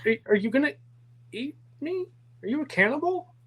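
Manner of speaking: high, stammering panic; acted fear, pitch shooting up; frightened. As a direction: squeak it out, alarmed and halting. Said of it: "his eyes bulge in fear".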